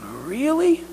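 put-on high child's voice, sweeping upward; hopeful little girl. Girl hears promise of two candy bars, hopeful little voice. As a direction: ask with wide-eyed, rising disbelief.

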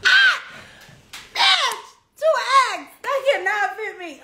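high, loud scandalised yelp; hammed-up shock at the dress size; mock outraged shock. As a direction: squeal it in disbelief.

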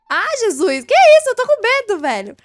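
very high, loud frightened cry; real fright, high and loud; scared scream. Real, not acted. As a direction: shriek at the jump scare. Jump scare in game.

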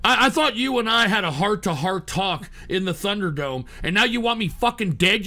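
usual speaking voice

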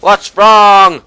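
high, loud, drawn-out shout; loud demanding shout. Real, not acted. Gamer shouts the question at his teammate, high and loud. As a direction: demand an answer.

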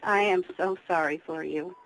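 ordinary talk

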